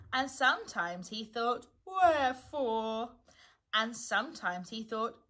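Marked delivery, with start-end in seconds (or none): 1.9-3.1 slow, mournful pondering; sad. A narrator's reading. Eeyore brooding alone in the forest, sadly. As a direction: stretch it out sadly and loudly. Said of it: "he thought sadly to himself".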